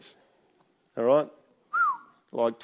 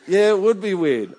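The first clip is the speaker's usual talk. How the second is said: amused loud agreement; 9 semitones higher, 9 dB louder than usual. Retold or imitated. Father agreeing with sons, amused.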